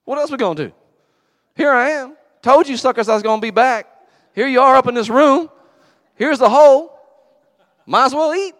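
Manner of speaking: high, loud, breezy buddy voice; casual Jesus. Retold or imitated. Preacher voicing Jesus as a casual buddy to the disciples. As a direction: play Jesus like a laid-back friend. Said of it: "He said".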